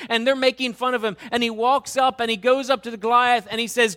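fervent sermon delivery; full-force preaching. Acted. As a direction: press the congregation with rising intensity.

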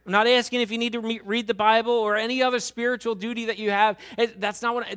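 fervent sermon delivery; full-force preaching. Acted. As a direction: press the congregation with rising intensity.

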